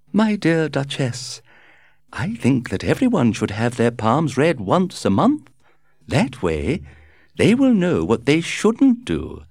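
high, chirpy, breezy society lady; gushing society hostess. A narrator's reading. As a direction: flutter through it like a gossiping hostess. Lady Windermere speaks.

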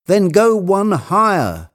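high, drawn-out command; ordering. A narrator's reading. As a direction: call an order up to someone above. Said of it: "Legrand ordered".

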